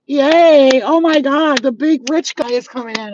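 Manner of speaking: high, loud hammed-up adulation; mock cheering. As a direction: cheer sarcastically like a gushing fan. Mocking applause for rich guy.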